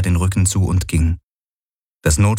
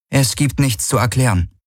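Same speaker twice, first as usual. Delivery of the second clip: higher-voiced, understated coolness; cool rebuff; 6 semitones higher than usual. A narrator's reading. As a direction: shut the conversation down coolly. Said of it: "sagte sie kühl". (kühl abweisend)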